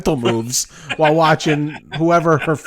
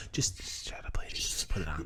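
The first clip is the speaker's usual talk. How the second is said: hushed, coaxing mock whisper; very soft, secretive play-acting; pretend furtive whisper; 9 semitones lower, 15 dB quieter than usual. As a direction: whisper as if sneaking something past someone. Acting out furtively putting mask on.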